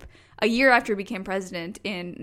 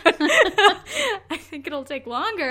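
laughing; 7 semitones higher, 4 dB louder, much slower than usual. Retold or imitated. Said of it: "He goes".

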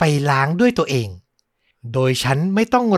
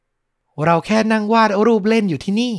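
put-on little girl; 8 semitones higher, 3 dB louder, slower than usual. A narrator's reading. Daughter voiced in story.

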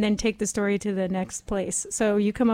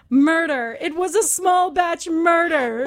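higher, urgent cry down the phone; dramatic cry of murder; 7 semitones higher than usual. Acted. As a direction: shout it into the phone like a dying dame. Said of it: "she dialed the number and said".

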